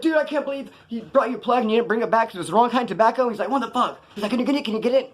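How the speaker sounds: high, frantic, breathless pleading; mock panic, like he can't get air; panicked hyperventilating friend. Homeboy freaking out over the lost tobacco, high and frantic. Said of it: "He started hyperventilating".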